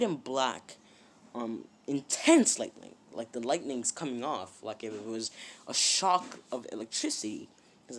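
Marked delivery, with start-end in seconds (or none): none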